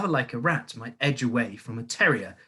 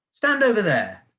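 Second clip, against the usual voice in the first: higher, louder snapped command; barked order; 10 semitones higher, 8 dB louder than usual. A narrator's reading. Headmistress ordering boy aside, a loud bark. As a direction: point and order him aside. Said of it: "the Trunchbull ordered".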